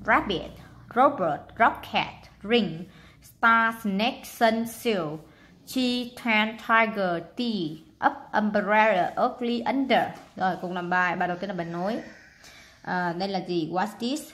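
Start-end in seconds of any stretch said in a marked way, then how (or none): 0.1-10.0 loud, clear word list for the class; read-aloud drill. Shown as a demonstration. Teacher reading the vocabulary list aloud to the class. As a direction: call out each word for kids to repeat. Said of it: "Rồi, đọc nè".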